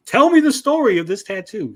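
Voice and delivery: loud, high-pitched imitation of an eager listener; mock insistent outburst; hammed-up demand. Voicing character eagerly demanding the story.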